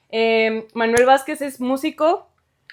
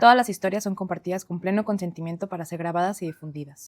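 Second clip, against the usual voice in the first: softer, even read of the podcast's legal notice; disclaimer read; 3 semitones lower, 6 dB quieter than usual. Acted. Host reading the episode disclaimer to listeners, soft even read. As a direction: read the fine print calmly, a touch softer.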